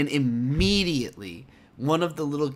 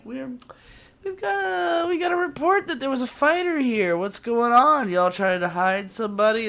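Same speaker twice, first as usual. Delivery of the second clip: much higher, louder soldier demanding answers; 10 semitones higher, 5 dB louder than usual. Retold or imitated. Zeon soldiers bursting into the camp, high loud demand. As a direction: play a barking suspicious soldier. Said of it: "they're like".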